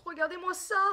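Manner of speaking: high, thrilled gushing; amazed delight. Real, not acted. Opens product, thrilled by its beauty.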